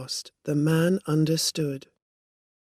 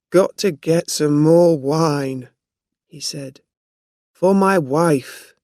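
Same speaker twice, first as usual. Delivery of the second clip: put-on drunk man; 9 dB louder than usual. A narrator's reading. Drunk man mumbling, leaving.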